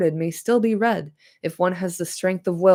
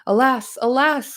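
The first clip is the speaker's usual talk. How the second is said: crying out alas; 6 semitones higher than usual. Acted. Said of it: "cried Legolas".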